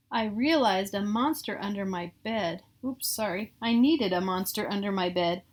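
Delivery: ordinary delivery, nothing marked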